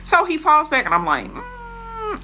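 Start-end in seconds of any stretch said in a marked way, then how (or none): 1.3-2.2 unimpressed mm. Retold or imitated. Refusing to help him.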